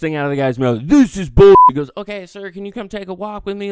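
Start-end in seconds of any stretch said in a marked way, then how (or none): 0.8-1.5 loud, high burst of outrage; acted angry shout. Customer's first words to manager, angry shout. 2.0-3.7 softer, higher-pitched diplomatic request; acted calming manager. Manager to irate customer, quietly placating.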